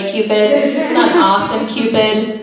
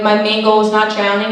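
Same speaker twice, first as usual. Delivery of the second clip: normal talking voice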